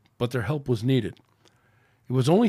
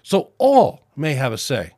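spouted; 3 semitones higher, 7 dB louder, slower than usual. Acted. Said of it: "spouted Sanshu".

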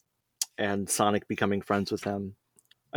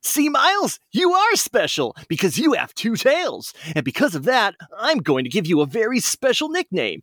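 high, proud cartoon hero voice; Sonic voice; 8 semitones higher than usual. Sonic giving the little fox his nickname, high hero voice. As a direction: bestow the nickname like a big brother. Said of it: "Sonic speaking to Miles".

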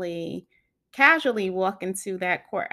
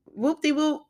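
playfully cranky; 8 semitones higher, 7 dB louder than usual. Real, not acted. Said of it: "I am just ... cranky".

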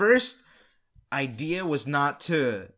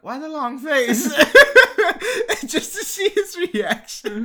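laughing; 13 semitones higher, 9 dB louder than usual. Retold or imitated.

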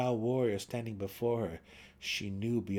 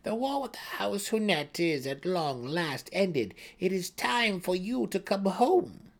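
high, honeyed invitation home; coaxing priestess; 9 semitones higher, 4 dB louder than usual. A narrator's reading. Brisa coaxes Drizzt to come home, honeyed. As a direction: lure him back gently.

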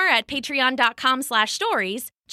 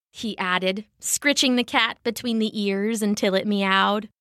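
ordinary delivery, nothing marked

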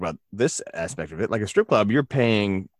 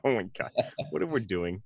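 ordinary talk, nothing marked

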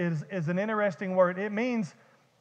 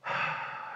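long, soft breathy sigh; acted sigh of someone just woken; weary sigh; 11 dB quieter than usual. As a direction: sigh out slowly, unbothered. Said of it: "And he goes".

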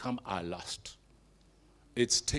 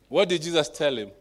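ordinary delivery

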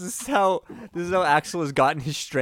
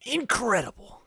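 high, hammy gasp of wonder; mock amazement; 10 semitones higher than usual. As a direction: overplay the astonishment. Hammed-up amazement at trick.

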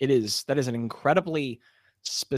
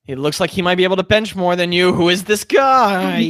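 awed exclamation; 5 semitones higher, 9 dB louder than usual. Acted. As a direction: gasp at how huge he is. GM voicing awe at stacked stranger.

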